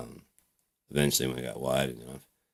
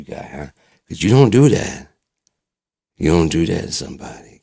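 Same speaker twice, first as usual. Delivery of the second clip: loud menacing rant with sudden peaks; pissed-off threat; 2 semitones higher, 7 dB louder than usual. Acted. Patient vows revenge on the doctor, pissed off. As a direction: build from menace to outraged scolding. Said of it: "I was so pissed".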